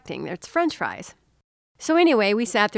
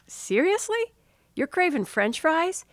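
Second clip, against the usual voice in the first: high-pitched disbelieving question; incredulous; 7 semitones higher than usual. Retold or imitated. Wife questioning partner's sudden French fry craving, incredulous. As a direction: react as if you can't believe it.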